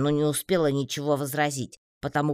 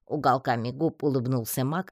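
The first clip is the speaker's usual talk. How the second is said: ordinary delivery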